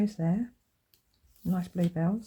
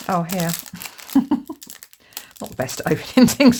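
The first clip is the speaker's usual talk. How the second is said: normal talking voice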